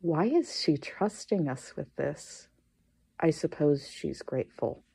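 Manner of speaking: normal talking voice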